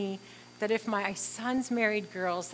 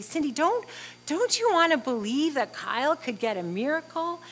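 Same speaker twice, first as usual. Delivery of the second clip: high, louder pleading question; imploring; 6 semitones higher, 5 dB louder than usual. Retold or imitated. Son's girlfriend urging his mother to believe, imploring. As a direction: voice an eager, urging young believer.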